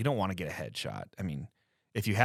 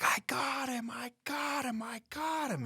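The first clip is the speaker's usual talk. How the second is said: high, breathless cries of I got him; overjoyed boy's first kill, high and airy; imitating excited son. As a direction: relive the kid's thrill, pitched way up. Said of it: "he's like".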